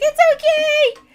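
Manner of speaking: loud, very high hammed-up wail; mock sobbing. Upset wife answering her husband's call, exaggerated tearful wail. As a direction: blubber it out at the top of your range. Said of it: "I was just started sobbing".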